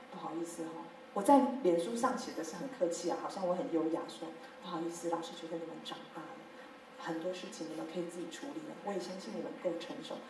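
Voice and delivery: soft, refined, overly courteous teacher voice; mock elegant politeness. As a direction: play the graceful, polite teacher.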